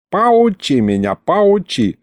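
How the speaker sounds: put-on scoffing old man, mildly scornful; snorting scorn. A narrator's reading. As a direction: scoff at the unwanted advice. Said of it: "фыркнул дед". (дед, пренебрежительно фыркая)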